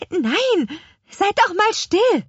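agitated, high-pitched command; urgent hushing. Acted. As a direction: cut them off urgently. Witch hushing companion to listen.

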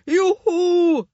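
thrilled little apple men cheering. Acted. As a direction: shout with glee.

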